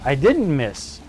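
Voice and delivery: usual speaking voice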